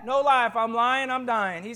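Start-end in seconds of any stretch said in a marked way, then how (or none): none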